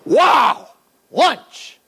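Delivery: high, loud hungry delight; hammed-up hungry fish; excited fish. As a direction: gobble the bait with glee. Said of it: "The fish goes".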